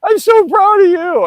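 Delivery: exaggerated crying old man, wailing loudly; imitating his drunk dad crying. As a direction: ham up the sobbing drunk father gushing with pride. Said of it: "and he was crying, like".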